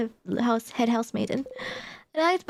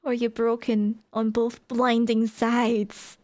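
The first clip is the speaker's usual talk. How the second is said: ordinary talk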